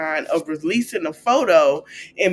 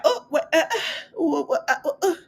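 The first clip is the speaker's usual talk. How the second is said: mock stunned stammer; 11 semitones higher, faster than usual. Mimicking people stunned by shade.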